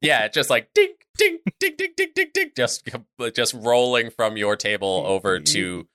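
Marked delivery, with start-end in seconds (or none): 0.0-2.9 high ding sounds. Shown as a demonstration. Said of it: "coin rolling sound effect".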